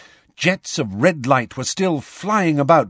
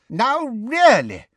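put-on slow, much higher fretful old voice; anxious; 12 semitones higher, 3 dB louder, slower than usual. A narrator's reading. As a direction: fret over the lost exam time. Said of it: "said Professor Tofty anxiously".

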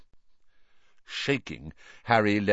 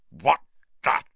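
louder, menacing uncle through teeth; through gritted teeth; 7 semitones higher, 6 dB louder than usual. Acted. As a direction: snarl it through clenched jaw. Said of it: "through gritted teeth".

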